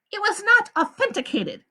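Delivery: a high, smooth woman's voice; the warden. A narrator's reading. The warden explains to the visitors, high and smooth. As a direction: lie calmly to the visitors.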